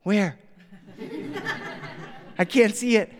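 lightly voiced puzzled toddler; three-year-old girl. Three-year-old looking the wrong way, high puzzled child voice. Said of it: "my three-year-old daughter".

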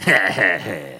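deep menacing goddess laugh; evil low cackle. Acted. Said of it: "she looking at them saying".

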